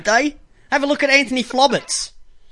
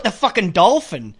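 ordinary delivery

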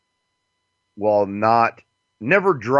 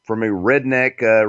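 ordinary delivery

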